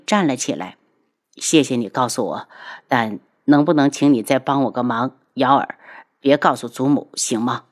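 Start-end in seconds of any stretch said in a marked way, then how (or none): 1.4-7.6 low, subdued male voice; subdued after tears. A narrator's reading. Cousin begging Yao'er to keep the secret, low and downcast. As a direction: humbly ask a favour, pitched down. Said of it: "韩清风擦干了眼泪".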